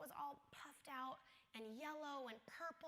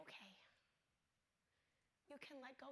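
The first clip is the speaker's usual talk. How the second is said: gentle release; 11 dB quieter than usual. Retold or imitated. Sister asks to let go, softly reassuring. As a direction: give her permission softly. Granting dying sister's wish.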